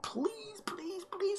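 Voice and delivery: tiny, very high soft pleas; squeaky begging. Real, not acted. Streamer begging a download to finish, squeaky pleading.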